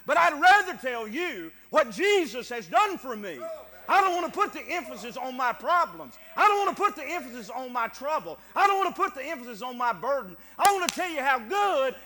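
loud, impassioned pulpit testimony; full-force preaching. Real, not acted. Preacher testifying how good God has been, real full-force preaching. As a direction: drive each phrase home to the congregation.

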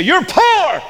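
high, loud mock cry; hammed-up high exclamation. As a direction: call it out high like a loud accuser. Acting out what men say.

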